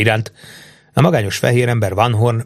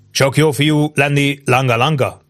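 slow, slightly higher courteous question; polite, unflappable; 4 semitones higher than usual. A narrator's reading. Captain opens small talk with the chief, politely unflappable. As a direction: ask evenly and politely, taking your time. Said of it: "kérdezte Van Horn udvariasan és rendíthetetlenül".

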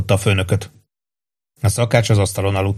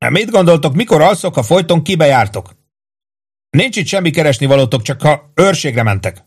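indignant higher-pitched scolding; affronted complaint, pitched up; 6 semitones higher than usual. A narrator's reading. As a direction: grumble at them like a put-upon cook. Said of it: "mondta méltatlankodva a szakács".

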